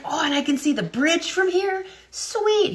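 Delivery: high, thrilled kid voice, imitating his delight; excited young kid. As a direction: play the kid who can't believe his luck. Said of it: "He's like".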